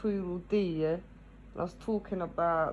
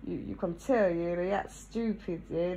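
ordinary delivery

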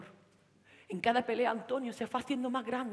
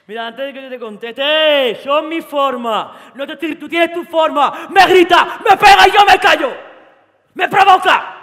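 much higher and louder, shouted; raging boyfriend; 5 semitones higher, 17 dB louder, slower than usual. Acted. Boyfriend yelling at his girlfriend in the park, raging. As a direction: explode at her.

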